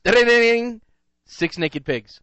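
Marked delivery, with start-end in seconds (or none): 0.0-0.8 high, loud ding-ding chime; correct-answer chime. Pretend. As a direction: sing a quiz-show ding. Game-show correct-answer chime.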